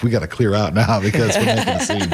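chuckling through a quote; laughing. Retold or imitated. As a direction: laugh while quoting. Retold crowd reacting, laughing.

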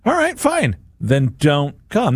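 high, huffy "fine then"; huffy dismissal. Retold or imitated. Retorting to the king's snub, mock huffy. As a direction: brush off the invite in a mock huff. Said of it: "That would have been my reaction".

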